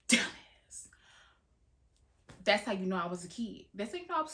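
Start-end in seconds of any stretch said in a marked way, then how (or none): 0.1-0.9 whispered self-insult; whispered dumbass. Real, not acted. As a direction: hiss it at yourself under breath. Self-insult after the yell.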